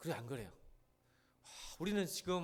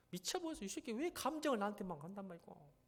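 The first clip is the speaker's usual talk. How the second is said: higher-pitched mock grievance; exasperated venting; 6 semitones higher than usual. Worker vents to God about the boss, acted exasperated complaint. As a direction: complain in acted exasperation without raising the volume. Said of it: "감정이 힘들고 짜증날 때".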